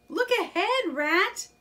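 high, loud warning cry. Acted. Mole warns Rat of the bank, high loud cry. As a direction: yell the warning before the crash. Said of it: "cried Mole".

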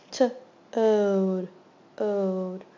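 segment by segment; sounding out toad. Shown as a demonstration. Teacher sounding out a word for students, segmented. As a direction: split toad into T and ode. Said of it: "Long O".

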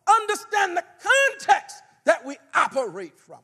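loud, driving sermon delivery; preaching at full force. Acted. Preacher teaching the church at full force. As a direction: press the history on them with force.

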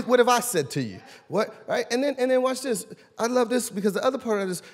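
usual speaking voice

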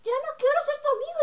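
very high, loud mocking imitation; Daniela. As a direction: mimic a friend's squeal of rejection. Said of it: "Ella me dice".

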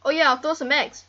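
loud eureka burst about the eggs; sudden idea. Real, not acted. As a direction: blurt it out as it hits you. Sudden idea, repeated calmer.

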